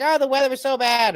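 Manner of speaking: high-pitched mocking whine; mock complaint. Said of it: "you'll be like".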